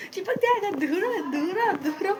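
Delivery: high mock panicked yell; imitating mom's yell. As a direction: shriek it like a scared mother. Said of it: "भाई जो चिल्ला रही मेरी mummy".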